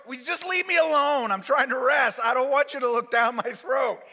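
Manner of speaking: loud, high complaint; acted fed-up patient; exasperated protest. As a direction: beg loudly to be left alone.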